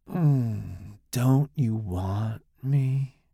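very slow seductive coo; sexy seductive voice. Acted. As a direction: lure him in, slowly. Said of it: "in the same voice".

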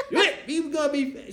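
put-on high, loud, laughing teen voice; laughing mock echo. Niece mocking his claim through laughter. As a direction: laugh at the idea while repeating it.

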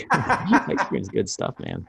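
slow, laughing through the words; amused laugh while agreeing. Real, not acted. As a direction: chuckle it out. Laughing after joke.

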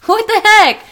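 high, loud incredulous cry; hammed-up shocked protest; mock outrage. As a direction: exclaim it in comic disbelief. Voices reaction to dropped kid.

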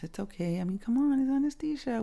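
normal talking voice

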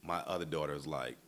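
usual speaking voice

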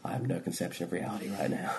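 spaced-out, soft and distant; barely there, lost in the trip; dazed whisper. Retold or imitated. Answering while tripping, dazed.

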